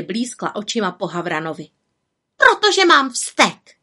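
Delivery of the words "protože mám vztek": high, loud, slow and cross; angry outcry. A narrator's reading. Little witch admitting her temper to raven, angry cry. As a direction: blurt out the temper. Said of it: "vykřikla zlostně".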